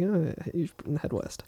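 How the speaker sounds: slow, very soft mumble; mock mumbling. Podcaster shows how the professor just gives up, mumbling. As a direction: mumble vaguely, barely getting words out. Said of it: "he's just mumbling".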